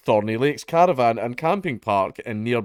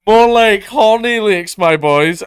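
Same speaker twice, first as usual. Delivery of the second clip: much higher and louder, slow, cackling at his own joke; gleeful pun shout; 10 semitones higher, 10 dB louder, slower than usual. Pretend. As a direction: crow the pun out to the lads, drawn out. Punning on the town name.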